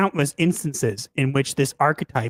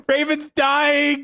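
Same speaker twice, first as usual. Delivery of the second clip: laughing; 7 semitones higher, slower than usual. Real, not acted. Announces friend laughing.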